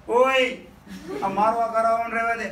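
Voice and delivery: mock yelling at the wife; shout. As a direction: yell at her to go play elsewhere. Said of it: "રાડ નાખી".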